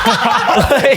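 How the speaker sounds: high laughing burst; real laugh. Real, not acted. Friends laughing after sniffing salts.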